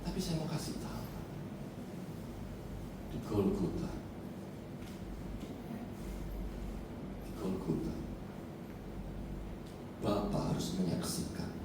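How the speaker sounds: very soft, low, long pauses; hushed solemn preaching. Acted. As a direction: let each phrase sink in gravely. Preacher solemnly recounts Golgotha. (khotbah lirih dan khidmat)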